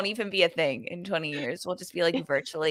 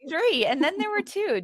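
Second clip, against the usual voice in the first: host intro; 10 semitones higher, 5 dB louder, faster than usual. Acted. Show host welcome back.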